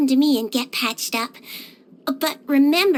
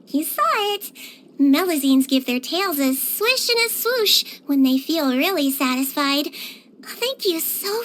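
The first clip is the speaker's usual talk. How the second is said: ordinary delivery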